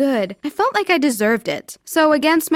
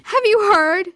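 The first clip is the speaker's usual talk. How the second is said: high, loud urgent call; crying out; 11 semitones higher, 4 dB louder than usual. Acted. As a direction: burst in with the news. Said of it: "she cried".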